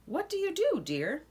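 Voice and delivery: rising then dropping soft tag; refrain question. A narrator's reading. Narrator asking children the refrain, soft.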